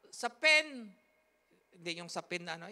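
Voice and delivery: ordinary talk, nothing marked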